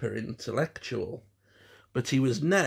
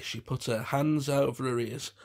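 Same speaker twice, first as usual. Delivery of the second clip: ordinary delivery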